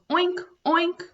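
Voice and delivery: high oinks with a lift; silly pig sound for kids; pig oinking. Shown as a demonstration. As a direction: oink like a happy piglet. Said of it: "Pink and goes".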